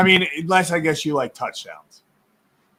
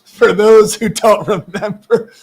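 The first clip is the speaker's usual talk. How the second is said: laughing while talking, higher and louder; 5 semitones higher, 6 dB louder than usual. Real, not acted. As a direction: tease through bursts of laughter. Laughing teasing about the Wi-Fi.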